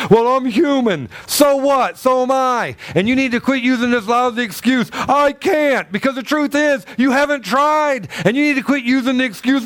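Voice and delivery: high, driving sermon voice; fiery preaching. Acted. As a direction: preach it hard to the pews. Preaching at full force.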